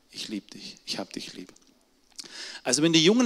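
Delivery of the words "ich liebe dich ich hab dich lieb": leise geflüstert, ganz innig; ehrliches Flüstern. Shown as a demonstration. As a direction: hauche es zart wie ein Geständnis. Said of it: "Vor diesem ehrlichen". (geflüstertes, ehrliches „Ich liebe dich“)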